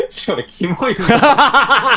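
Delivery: high, slow words through real laughter; cracking up at how gross the line sounds; laughing. Real, not acted. Host laughing at the cringy Japanese line.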